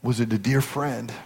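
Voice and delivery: low, hushed question; careful, low-voiced inquiry; gentle pastor. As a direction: probe softly, as to a mourner. Pastor gently questions grieving man.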